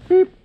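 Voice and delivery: short, high electronic blip by mouth; imitated beep. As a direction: mimic a gadget's single beep. Imitates the camera's signal.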